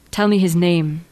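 plain reading voice

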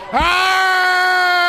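high shouted pirate "Arr"; pirate growl. Acted. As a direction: let out a big buccaneer growl. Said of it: "Talk Like a Pirate Day".

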